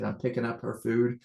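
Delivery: ordinary delivery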